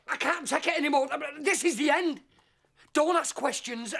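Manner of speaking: ordinary delivery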